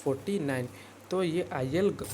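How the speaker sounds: usual speaking voice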